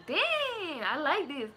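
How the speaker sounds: high, drawn-out mock amazement; retold delight. Streamer recalls tasting nanny's Dominican dishes, in high-pitched retold delight.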